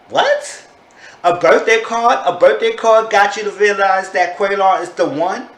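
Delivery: high, loud disbelieving questions; incredulous. Retold or imitated. Reviewer scoffs at her falling for a card, incredulous.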